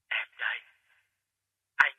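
barely audible, hollow whisper; faint distant whisper. Acted. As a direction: sound far away and fading. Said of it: "Cái giọng nói của ông Phát nhỏ lắm, nghe cứ loáng thoáng".

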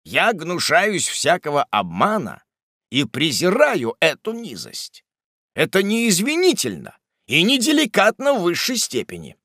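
measured reproach rising in pitch; bitterly reproachful old gentleman. Acted. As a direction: rebuke with wounded dignity. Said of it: "тоном горького упрёка".

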